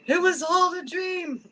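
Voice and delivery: high, loud, slow proclamation; mock-dramatic exclamation. Speaker joking after the sea witch recap, mock-dramatic. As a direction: call it out slowly, high and loud.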